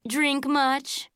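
sneering little taunt, milder; a lighter mean-girl sneer; snotty. A narrator's reading. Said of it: "in a snotty voice".

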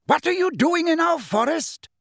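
very high-pitched, angry centaur cry; outraged high yell; bellowed challenge. A narrator's reading. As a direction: bellow it at the trespassers, voice soaring. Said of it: "bellowed the hard-faced grey centaur".

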